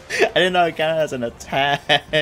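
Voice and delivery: laughing. Real, not acted. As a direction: burst out laughing mid-sentence. Surprised laughing at game effect.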